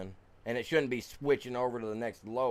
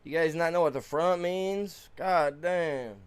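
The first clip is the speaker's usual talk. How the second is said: loud disbelieving complaint; exasperated shout; 6 semitones higher, 6 dB louder than usual. Real, not acted. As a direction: vent it out loud. Exasperated at loading failure.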